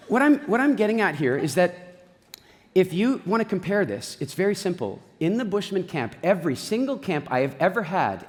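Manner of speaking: ordinary talk, nothing marked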